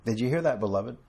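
ordinary delivery

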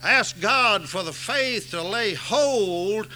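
rising, loud sermon climax; preaching at full force. Acted. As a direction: drive the gospel home from the pulpit. Preaching to the congregation.

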